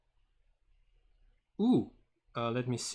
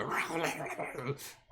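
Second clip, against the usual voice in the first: growly chomping of feeding sharks; shark devouring noise; 4 semitones higher than usual. Acted. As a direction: imitate sharks devouring something. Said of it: "you hear the".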